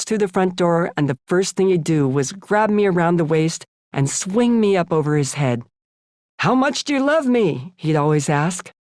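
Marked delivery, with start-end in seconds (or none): 6.4-7.7 playful dad. A narrator's reading. Father swings child, asks playfully.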